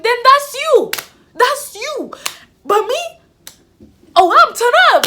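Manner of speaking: high, loud mock sass; brash retort. Student answering the deputy's milk remark, brash retort. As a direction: throw it back loud and high.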